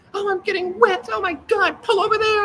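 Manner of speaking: high, whiny mock complaint from the back seat; nagging girlfriend. Man mocks a nagging girlfriend on his scooter, high whiny voice. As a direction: mimic a fussy girl who hates the rain. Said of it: "she doesn't nag me".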